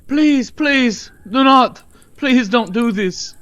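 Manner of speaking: high, loud desperate begging; a son's frantic scream at the door; screaming plea. Acted. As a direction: shriek it, begging for mercy.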